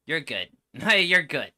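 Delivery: usual speaking voice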